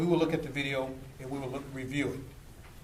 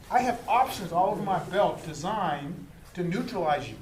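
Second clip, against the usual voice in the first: ordinary talk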